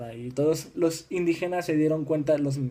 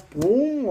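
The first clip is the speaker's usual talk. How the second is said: high-pitched spoken boom; vocal sound effect for a sudden blow; imitated impact; 9 semitones higher than usual. Imitated impact in battle story. (onomatopeya aguda de golpe)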